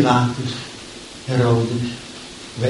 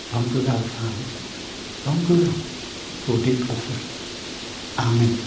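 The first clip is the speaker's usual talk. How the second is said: prayer. Acted. Pastor praying before the congregation, hushed and earnest.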